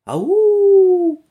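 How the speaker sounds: high, drawn-out hollow call; echo. Acted. Forest echo answers the lost boys, a long ringing echo. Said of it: "ответило эхо".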